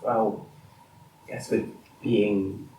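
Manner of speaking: soft, halting hush; hesitant, very quiet near-whisper. Acted. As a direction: speak under the breath, in broken phrases. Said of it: "Now can you please stop whispering?".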